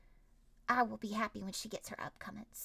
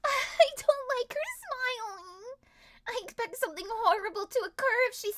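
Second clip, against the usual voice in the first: wary dread; 11 semitones higher, 8 dB louder than usual. Real, not acted. Repeats it seriously.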